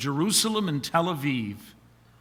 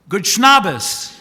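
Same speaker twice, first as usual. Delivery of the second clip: loud, high, hearty Sabbath greeting; beaming good morning to his wife; cheery greeting; 4 semitones higher, 9 dB louder than usual. Acted. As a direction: belt out the punchline greeting.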